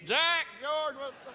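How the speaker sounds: put-on calling the kids. Calling the kids about the mess.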